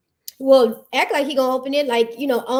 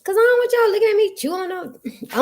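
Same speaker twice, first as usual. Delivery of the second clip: defensive; 9 semitones higher, 3 dB louder than usual. Real, not acted. Defensive reply, high pitch.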